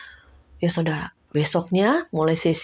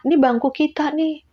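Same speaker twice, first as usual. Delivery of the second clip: high insistent claim; 8 semitones higher than usual. Retold or imitated. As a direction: claim the bench, pitched up. Claiming the seat back. (protes ngotot bernada tinggi)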